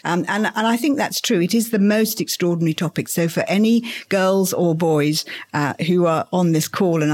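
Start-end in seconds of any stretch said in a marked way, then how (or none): none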